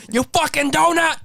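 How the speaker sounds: high, shrieking chef insult; Gordon Ramsay yell. Retold or imitated. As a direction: scream it like an angry chef.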